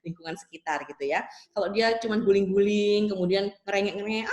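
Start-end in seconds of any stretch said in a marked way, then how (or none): none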